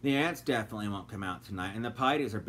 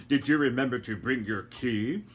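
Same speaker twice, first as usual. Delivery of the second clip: lightly voiced gentleman; 4 dB louder than usual. A narrator's reading. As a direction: say it unhurried, brushing her off. Doctor Fogg.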